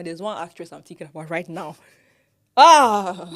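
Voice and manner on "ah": sudden loud exclamation; loud exasperated cry. Real, not acted. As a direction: let out a loud "Ah!". Exclamation about actress.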